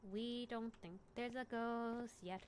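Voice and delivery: slow, measured disclaimer; slow, deliberate. Real, not acted. Storyteller stressing to listeners where the tale stands, slow and deliberate. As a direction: spell it out slowly, word by word.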